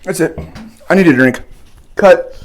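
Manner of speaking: half-shout, mouth on fire; urgent loud call. Real, not acted. Overwhelmed by spice.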